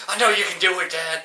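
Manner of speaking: rally him in the last round; mock son. Son urging his aging father in the final round, mock cheer. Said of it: "he's like".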